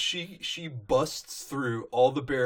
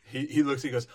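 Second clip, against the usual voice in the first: ordinary delivery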